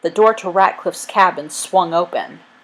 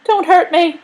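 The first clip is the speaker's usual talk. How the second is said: very high, loud frightened plea; pleading; 11 semitones higher, 5 dB louder than usual. A narrator's reading. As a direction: beg in a high scared voice. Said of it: "Wiggins pleaded".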